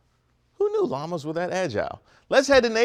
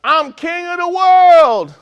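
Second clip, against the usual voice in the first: big, drawn-out triumphant yell, pitched way up; 14 semitones higher, 10 dB louder, slower than usual. Acted. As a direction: holler it high and long like a victory cry. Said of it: "he yells out".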